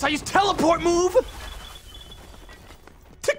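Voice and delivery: loud, high triumphant boast; hammed-up villain gloat. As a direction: gloat over the beaten goblin.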